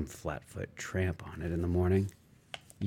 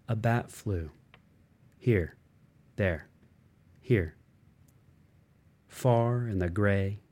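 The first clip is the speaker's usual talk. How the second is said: plain reading voice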